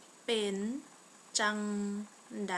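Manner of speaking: slow, one word at a time; long pauses between words for a learner. Shown as a demonstration. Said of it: "more slowly".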